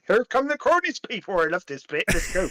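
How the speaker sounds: playful Cornish burr; West Country Cornish accent. Pretend. Co-host hyping up a favourite bit, Cornish accent. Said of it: "in the West Country Cornish accent".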